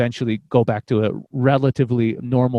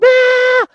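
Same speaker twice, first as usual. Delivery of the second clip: sudden high scream of terror, jolted from sleep; a loud, shrill scream ripped out of sleep; terrified scream; 8 dB louder than usual. Retold or imitated. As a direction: let out a piercing scream like waking from a nightmare. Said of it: "I'd wake up going".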